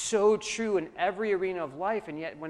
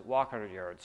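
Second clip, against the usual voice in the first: dropped much lower for walk; walking pace; 7 semitones lower, slower than usual. Shown as a demonstration. Pastor to congregation, walk line dropped low. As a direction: let the voice fall low on walk. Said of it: "sprint hundred yards".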